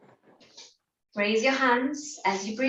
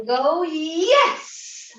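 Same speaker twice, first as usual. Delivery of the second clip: triumphant "yes!" with a fist pump; cheer; 6 semitones higher, 3 dB louder than usual. Shown as a demonstration. Leader shows the victory cheer, pitched higher. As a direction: punch the air and cheer. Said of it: "Gonna raise our hands, make a fist".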